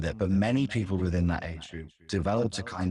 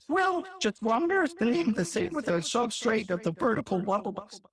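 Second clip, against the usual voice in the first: much higher put-on voice rambling nonsense; mock Peterson ramble; 13 semitones higher than usual. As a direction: parody a high dreamy professor. Mocking Peterson rambling to Dawkins.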